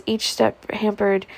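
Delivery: plain reading voice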